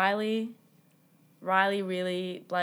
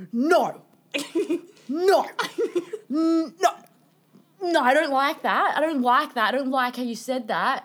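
retold firm loud objection; 4 semitones higher, 4 dB louder than usual. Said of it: "Alice just stands up and is like".

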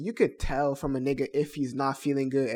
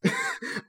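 short breathy laugh. Real, not acted. Reacting to friend's confession.

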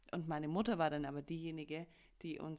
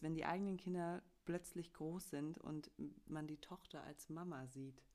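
ordinary talk, nothing marked

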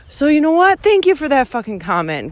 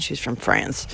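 ordinary delivery

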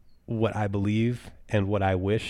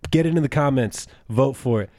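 raised, higher-pitched urging; insistent call to the audience; rallying viewers; 6 semitones higher, 4 dB louder than usual. Real, not acted. Host urging viewers to vote for his name, rallying.

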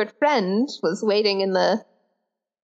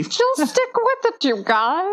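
high mock voice; 10 semitones higher, slower than usual. Joining the mock creator voice.

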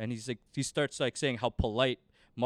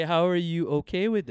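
raised, louder voice of disbelief; incredulous; 7 semitones higher, 6 dB louder than usual. Retold or imitated. Storyteller replaying his question to the sister's brother, incredulous. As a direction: push it high and loud, retelling your bafflement.

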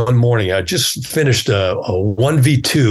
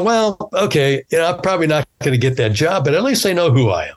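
high retold thought; 6 semitones higher than usual. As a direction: say it high, shrugging it off. Retold thought after leaving.

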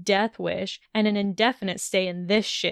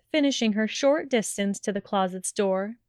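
usual speaking voice

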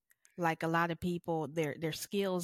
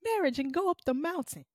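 high, hammed-up heroic vow; mock-dramatic declaration; 9 semitones higher, 3 dB louder than usual. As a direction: play the embattled spouse, voice pitched way up. Acts out fighting for a marriage.

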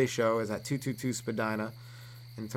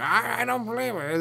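high, stammering protest; worked-up disbelief; 11 semitones higher than usual. Acted. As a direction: overreact like a defensive customer. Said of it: "getting so worked up".

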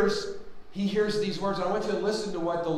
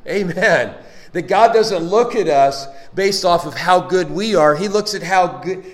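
loud, driving preacher delivery; preaching; 9 dB louder than usual. Acted. Preacher urging the congregation that Christ lives within, loud. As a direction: build it up and press it home to the church.

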